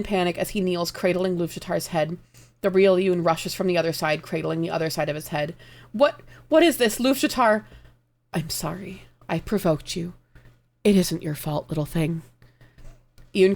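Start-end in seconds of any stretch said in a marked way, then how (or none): 5.9-7.6 high, loud panicked plea; panicked cry. Acted. Iyun panics over dying Luvjatar, high and loud. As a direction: cry out his name in shock.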